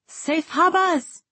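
high, drawn-out farewell call; far-carrying shout with dropped Rs; shouted after them. A narrator's reading. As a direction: call out slowly to people walking away. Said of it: "Ryber shouted after them".

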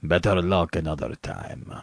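low, soft touch of the general's voice; lightly voiced general calling. A narrator's reading. General calling down to his hounds, in a lightly put-on low voice. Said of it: "and he called".

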